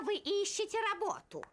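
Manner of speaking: normal talking voice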